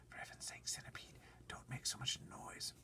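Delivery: urgent hushed plea; soft whisper. Acted. James hushing the noisy centipede. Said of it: "James said softly".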